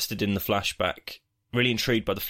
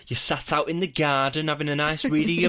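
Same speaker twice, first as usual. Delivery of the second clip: lightly northern housewife chatting about the weather, a bit higher; northern middle-aged woman; 7 semitones higher than usual. Acted. Host mocks weather small talk, mild northern woman voice. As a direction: put on a gossipy northern neighbour voice, a touch higher. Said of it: "I go so northern when I'm doing this".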